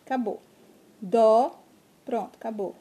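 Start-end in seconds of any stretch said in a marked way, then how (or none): 1.0-1.6 "Dó" in one loud beat; one strong push of voice on "Dó"; single burst of voice. Shown as a demonstration. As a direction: punch out "Dó" as one syllable. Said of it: "Você emite a voz uma vez só".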